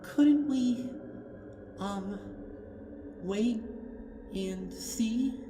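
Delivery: very high, pleading little voice; timid Fluttershy. Acted. Fluttershy pleading with Twilight, very high and timid. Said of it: "Fluttershy's whisper".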